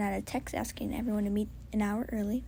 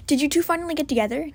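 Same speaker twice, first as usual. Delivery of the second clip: louder, quicker eager teasing; teasing excitement; 5 semitones higher, 8 dB louder, faster than usual. Acted. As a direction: blurt it out with glee. Chim teasing, louder and faster.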